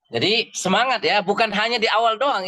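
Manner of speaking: energetic pep talk to students; rousing encouragement. Real, not acted. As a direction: fire them up to keep going. Teacher rousing students' enthusiasm.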